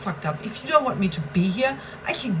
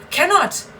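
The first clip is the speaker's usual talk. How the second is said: 1 emphatic outburst; 9 semitones higher, 5 dB louder than usual. Real, not acted. Venting, repeats word emphatically.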